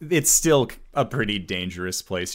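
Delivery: normal talking voice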